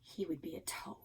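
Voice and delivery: hushed aside, breathy whisper. A narrator's reading. Narrator whispering to listeners about the toad. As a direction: drop to a secret whisper.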